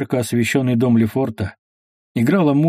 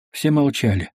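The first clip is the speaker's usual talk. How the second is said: plain reading voice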